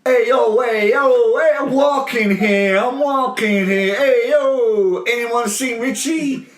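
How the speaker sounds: high, loud, stretched-out Brooklyn caricature; hammed-up Brooklyn accent with dragged vowels; mock Brooklyn accent. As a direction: overdo Seagal's bad Brooklyn accent. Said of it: "I'll do a perfect Brooklyn accent".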